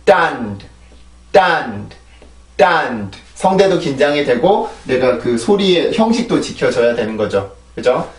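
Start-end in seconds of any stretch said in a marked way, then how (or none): 0.1-3.2 딴드 with a tense throat and a strong push of air; tense 딴드 drill. Shown as a demonstration. Teacher models 딴드 for the class, tense and clear. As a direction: repeat 딴드 slowly with a tense throat. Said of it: "이렇게 발음을 하는 게 아니라".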